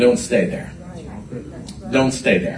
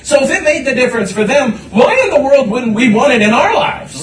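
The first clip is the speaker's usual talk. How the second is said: preaching fervor; 8 semitones higher, 4 dB louder than usual. Real, not acted. Preacher building to a peak.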